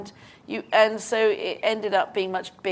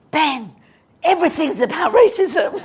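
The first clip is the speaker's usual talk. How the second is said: laughing retort; 9 semitones higher, 9 dB louder than usual. Retold or imitated.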